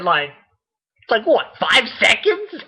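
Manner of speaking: normal talking voice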